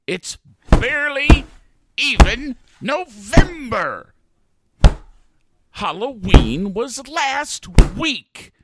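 loud, slow, furious shouting; angry yell. Acted. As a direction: yell it out word by word. Ranting at Santa.

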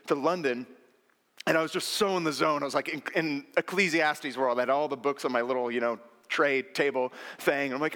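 ordinary talk, nothing marked

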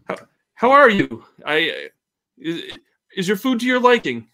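high, loud startled barmaid voice; a flustered cook caught off guard, pitched high and loud; startled Elizabeth greets a customer, high and loud. Acted. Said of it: "She's like".

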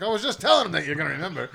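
high, loud, fast retold outburst; gleeful mock exclamation; excited told-you-so. Retold teasing told-you-so while laughing.

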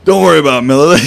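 loud, high quote bursting with laughter; laughing quote. Retold or imitated. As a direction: imitate him while cracking up. Quotes the sergeant while cracking up.

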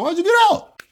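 high-pitched, baffled retort; incredulous question. Retold or imitated. Asking the former airman why he left, incredulous. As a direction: shoot up high in disbelief. Puzzled reaction to his praise.

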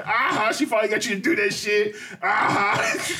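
high, cackling gotcha tease; teasing a friend who caved to his partner, high and gleeful. Retold or imitated. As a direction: gloat at him for finally giving in. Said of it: "And I said".

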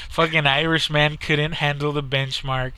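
normal talking voice

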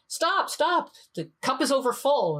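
high urgent stop cry; mock panic as tea spills over; retold alarm. Professor crying out as monk keeps pouring, alarmed.

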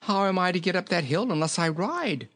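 high-voiced protesting king; high character-voice protest; remonstrating. A narrator's reading. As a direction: appeal to the goat, high and plaintive. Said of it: "remonstrated the king".

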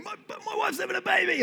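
high, breathless movie-hero plea; hammed-up desperate husband; classic movie plea. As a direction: beg the cop like a movie cliché. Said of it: "I could do the classic movies thing".

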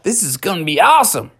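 big swooping "oh, yeah"; gleeful cheer, starting high and settling; thrilled to bits; delighted exclamation. Acted. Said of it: "And he said".

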